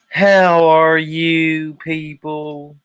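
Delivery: drawn-out, high, booming Southern preacher greeting; Southern preacher grift. Acted. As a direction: stretch every word like a showy Southern televangelist. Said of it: "Let me practice".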